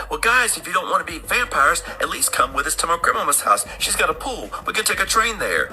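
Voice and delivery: put-on high-pitched coaxing girl; young girl. Young girl inviting travellers to grandmama's house, high and coaxing. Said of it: "So the young girl's like".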